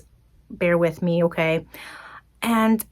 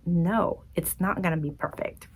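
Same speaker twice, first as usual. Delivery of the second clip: much softer, resigned admission; meek admission; 10 dB quieter than usual. Retold or imitated. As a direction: concede it quietly. Meek answer to God.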